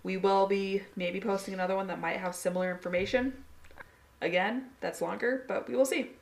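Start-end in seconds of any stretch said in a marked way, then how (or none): none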